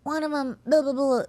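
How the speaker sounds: high-pitched, hammed-up little protest; mock indignant child. Overlooked daughter answering mother's visitors, mock indignant. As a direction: imitate an overlooked kid piping up.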